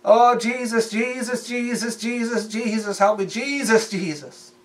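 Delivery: high, wailing imitation of needy prayer; mock desperate pleading. Preacher imitating people begging Jesus for help, mock desperate pleading. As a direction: beg over and over in a hammed-up high voice. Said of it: "to mock you, make fun of you".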